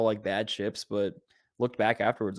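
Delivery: normal talking voice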